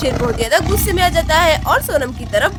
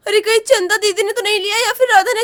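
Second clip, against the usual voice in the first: ordinary delivery, nothing marked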